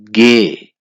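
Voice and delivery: गे, hard g with open e, called loud. Shown as a demonstration. Teacher naming the German letter G, loud clear call. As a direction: announce गे, the German G, loudly. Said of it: "गे बोलेंगे".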